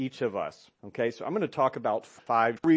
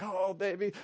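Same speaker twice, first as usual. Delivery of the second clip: mimicking tender wife; 7 semitones higher, 3 dB quieter than usual. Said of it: "my wife's like".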